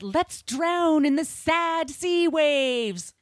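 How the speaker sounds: slow, high, swooning theatrical line; mock romantic drama. Host retelling Dickens dragging Eleanor into sea, swooning. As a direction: ham up the tragic lover. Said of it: "and said".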